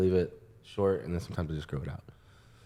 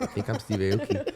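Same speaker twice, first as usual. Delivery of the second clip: laughing quote; 3 dB quieter than usual. Retold or imitated. Quoting himself, laughing.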